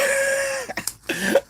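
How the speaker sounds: high-pitched burst of laughter. Real, not acted. Streamer amused by the chat, high laugh.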